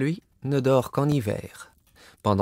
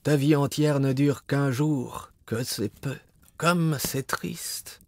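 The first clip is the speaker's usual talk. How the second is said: talking tree; 4 semitones higher, slower than usual. A narrator's reading. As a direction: sigh out the sadness gently. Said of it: "dit l'arbre".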